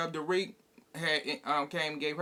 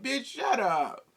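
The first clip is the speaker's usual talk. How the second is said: dismissive retort; 5 semitones higher, slower than usual. Retold or imitated. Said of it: "It's like".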